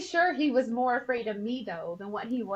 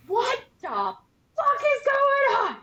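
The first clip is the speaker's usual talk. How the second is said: shouting high and loud, hammed-up fury; retold furious shout; 11 semitones higher, 5 dB louder than usual. Husband chewing out the soldier on the phone, mock furious shout. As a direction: bellow it down the phone like an outraged sergeant.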